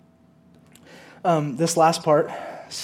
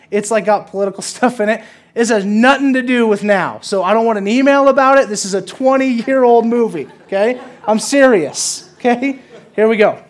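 louder, firmer insistence; emphatic warning; 5 semitones higher, 7 dB louder than usual. Acted. Pastor warning congregation off politics, louder and firmer. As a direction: make sure nobody mistakes you.

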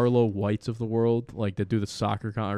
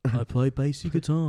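[name] voice. Acted. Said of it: "You can be a [name] too".

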